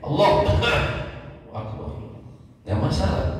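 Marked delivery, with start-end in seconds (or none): 0.1-1.9 Allah cut by a short cough, then Akbar; cough break. Shown as a demonstration. Teacher shows a takbir broken by a cough. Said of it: "Dia terpotong pendek".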